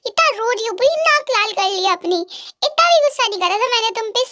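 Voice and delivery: plain reading voice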